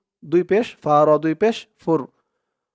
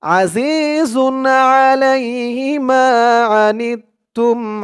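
slow, melodic tajweed chant; Arabic recitation as a model for learners; Quran recitation; 2 semitones higher, much slower than usual. Shown as a demonstration. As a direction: chant each verse slowly with long held vowels. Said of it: "ধরুন".

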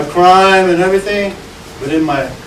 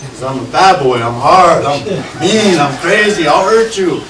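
ordinary talk

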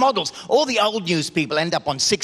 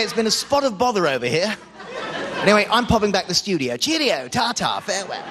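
posh English reporter. Pretend. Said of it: "I say".

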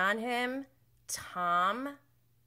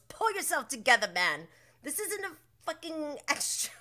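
scolding; 8 semitones higher than usual. Retold or imitated. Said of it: "James goes".

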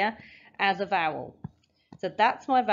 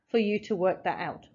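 ordinary delivery

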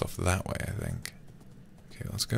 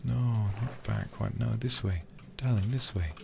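hushing whisper to calm her; soothing; 4 semitones higher, 7 dB quieter than usual. Real, not acted. Streamer calming his horse Juanita, soft shushing whisper. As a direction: soothe the horse, shh-ing softly.

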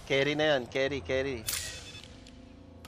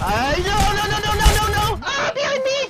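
rising, louder panicked cries; panicked screaming; 8 dB louder, faster than usual. Real, not acted. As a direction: scream in panic as you're caught. Panicking when attacked.

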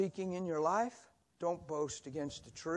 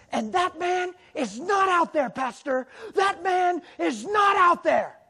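high, loud, slow yell; screaming; 13 semitones higher, 13 dB louder, slower than usual. Retold or imitated. Young woman screaming at her pastor in despair. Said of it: "I am screaming at the pastor".

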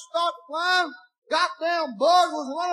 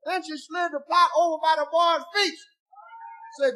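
ordinary delivery

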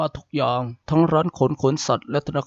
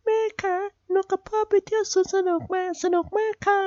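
put-on much higher child voice, cheerful; little girl voice. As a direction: play a happy little daughter back from the fair. Little daughter voiced by narrator.

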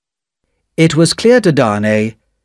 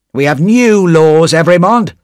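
sharp; 5 semitones higher, 4 dB louder than usual. A narrator's reading. Official answering Darnay's protest, sharply. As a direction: cut him off sharply. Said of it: "said the official sharply".

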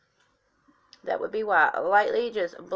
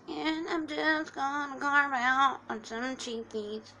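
odd put-on voice; 5 semitones higher, 4 dB quieter, slower than usual. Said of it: "I don't know why I'm talking like that".

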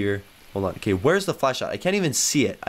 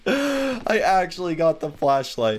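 gasping, high-pitched delight; drawn-out, elated celebration; so happy; 8 semitones higher, slower than usual. Real, not acted. Player turns the lights on with new flashlight, overjoyed. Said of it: "I'm so happy".

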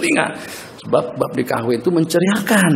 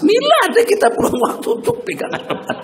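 laughing. Acted. Joke punchline with laughter.

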